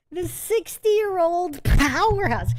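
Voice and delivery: slowed-down awestruck hype; slow hype emphasis. Real, not acted. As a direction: savor each word like a hype man. Hyping up an old champion.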